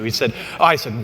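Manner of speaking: usual speaking voice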